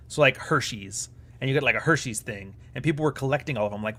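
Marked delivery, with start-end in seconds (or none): none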